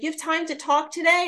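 imitating a sweet, high friendly question; sweet Tom. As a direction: sweetly check if he is free. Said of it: "He's so sweet".

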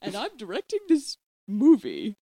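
swooping sing-song nanny; exaggerated up-and-down melody; Mary Poppins. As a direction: announce yourself with slow, theatrical lilt. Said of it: "I'm Mary Poppins".

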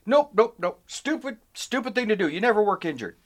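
high, emphatic repeated refusal; dismissive dad. Retold or imitated. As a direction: imitate a father flatly rejecting the idea. Dad's firm refusal acted out.

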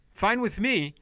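ordinary talk, nothing marked

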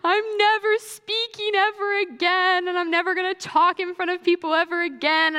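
put-on high, tearful teen wail; mock sobbing vow never to speak again; retold crying. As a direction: replay the bathroom meltdown, voice up high. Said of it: "and I was crying".

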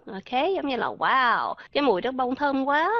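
usual speaking voice

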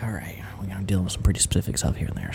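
whispered fast aside as the will-reading lawyer; hurried whisper to himself. Pretend. Mock lawyer whispers fast to himself while reading the will.

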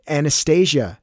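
loud call across the store. A narrator's reading. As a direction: call her name across the shop. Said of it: "she called".

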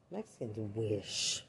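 ordinary delivery

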